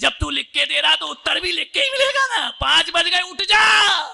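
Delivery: high, loud mocking shout; preacher shouts the wife's written wake-up note; loud punchline. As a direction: belt out the punchline for laughs.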